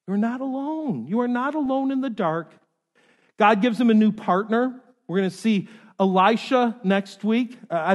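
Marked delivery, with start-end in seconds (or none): 0.1-2.5 higher-pitched, insistent reassurance; emphatic reassurance. Real, not acted. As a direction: press the comfort home with a lifted voice. Preacher lifts the reassurance to congregation.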